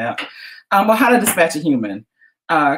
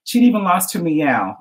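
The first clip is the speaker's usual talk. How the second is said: normal talking voice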